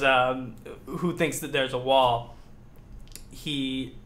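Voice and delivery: ordinary talk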